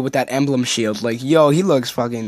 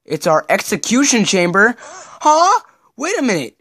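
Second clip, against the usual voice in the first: sudden jump to much higher alarm; alarmed shock; 8 semitones higher, 3 dB louder than usual. Acted. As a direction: gasp in panic as the trap springs. Trap revealed, party panics.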